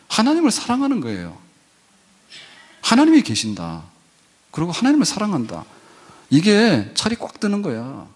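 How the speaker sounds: high, fervent preaching voice. Acted. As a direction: lift the key words with fervour. (높고 뜨거운 설교 어조)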